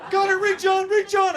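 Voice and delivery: very high, shouted mock desperation; panic attack. Stag begs friend to phone the lawyer, panicked shriek. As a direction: scream for help as the panicking stag. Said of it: "Will has a full-on panic attack".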